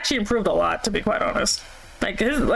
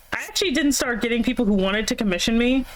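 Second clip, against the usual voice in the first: ordinary talk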